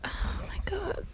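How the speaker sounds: breathless, voiceless gasp after laughing; near-silent awed laugh-gasp; whispered from laughing. Real, not acted. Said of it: "I'm in tears".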